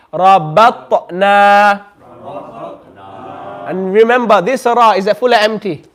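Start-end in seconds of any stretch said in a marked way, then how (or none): 0.1-1.8 very slow Rabatna, bounced t, long na; model qalqala reading. Shown as a demonstration. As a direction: echo the t in Rabatna and stretch the end. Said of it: "say after me".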